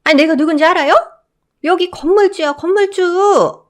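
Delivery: high, loud indignant yell; mother-in-law shouting at the guard, slowing on the last word. Acted. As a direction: shout that she owns the building. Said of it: "언성을 높이며".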